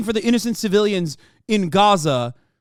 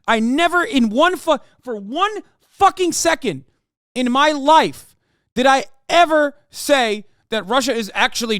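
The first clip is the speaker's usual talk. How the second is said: much higher, louder furious outburst; angry rant; 7 semitones higher, 4 dB louder than usual. Real, not acted. Streamer yelling back at a chatter over Russia, furious loud rant. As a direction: blow up at chat in real anger.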